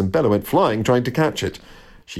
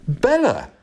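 high, sudden call of the name; exclaiming; 8 semitones higher than usual. A narrator's reading. Jack recognising his cat's voice, exclaiming. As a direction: cry it out on a high falling note. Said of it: "exclaimed Jack".

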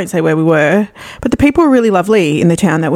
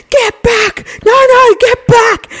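high, loud, frantic yelling; panicked shout; 4 dB louder, slower than usual. Retold or imitated. Boyfriend in his sleep shouting at her in terror. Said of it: "he's like".

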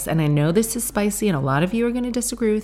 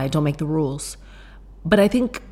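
ordinary delivery

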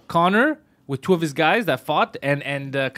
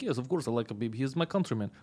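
low-key, much softer matter-of-fact reply; imitated Russian fan; 3 semitones lower, 10 dB quieter than usual. Russian fan asked if he likes Khabib, understated reply. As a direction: answer quietly as the loyal countryman. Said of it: "He's like".

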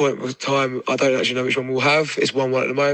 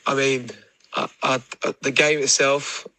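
ordinary talk, nothing marked

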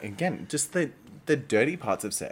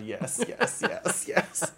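ordinary talk

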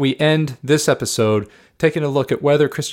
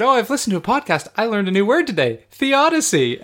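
imitating wife; 11 semitones higher, 4 dB louder than usual. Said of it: "So when you said".

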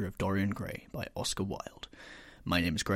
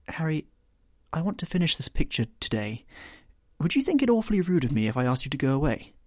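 higher, faster, worried painter; lightly voiced fretful painter; anxious Basil; 6 semitones higher, 5 dB louder than usual. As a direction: ask him to leave, hurriedly.

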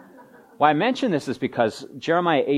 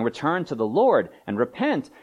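ordinary talk, nothing marked

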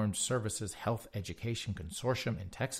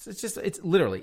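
exasperated; 9 semitones higher, 5 dB louder, faster than usual. Real, not acted. Exasperated outburst.

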